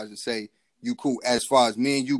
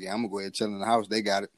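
fast, low, offhand; quick aside; 3 semitones lower, 4 dB quieter, much faster than usual. Retold or imitated. Husband leaving the fight to his wife, fast and offhand. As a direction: rattle it off and step back out of it. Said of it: "And I was like".

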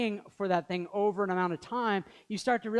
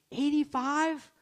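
mock disbelief; 8 semitones higher, slower than usual. Pastor mocking picky spouse-seeker.

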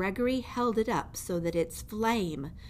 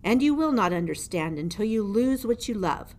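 louder, looming jailer voice taunting a mouse; menacing old jailer; 4 semitones higher, 7 dB louder, faster than usual. Acted. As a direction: lean over the prisoner and gloat. Gregory continues.

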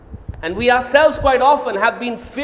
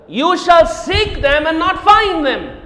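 louder, higher, slow; slow proclamation; 6 semitones higher, 6 dB louder, slower than usual. Acted. Preacher reading verse twelve with triumph. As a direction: weigh each word out.

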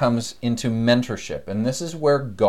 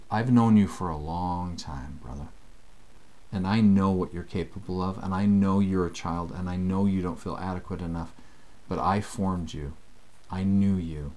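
reassuring Lord; 5 semitones lower, 5 dB quieter than usual. Retold or imitated. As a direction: soothe him like a patient father. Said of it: "the Lord gives this reassurance".

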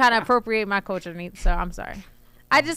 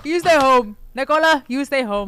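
loud, raised-pitch imitation Serbian accent; mock Serbian accent; 4 semitones higher, 5 dB louder than usual. Playing a Serbian relative keeping Nicola home, loud mock accent. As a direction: order it like a caricatured big Serbian guy.

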